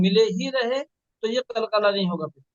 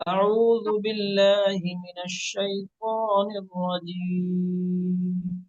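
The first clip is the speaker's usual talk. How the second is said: औज़ु बिल्लाहि मिनश शैतानिर रजीम recited very slowly, every syllable drawn out; slow model recitation; much slower than usual. Shown as a demonstration. Teacher models slow recitation for student. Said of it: "सिखाया गया उस तरह पढ़िए".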